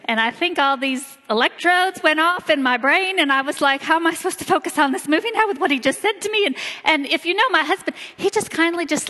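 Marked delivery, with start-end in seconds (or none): 3.8-6.5 flustered. Retold or imitated. Retelling her flustered inner thought.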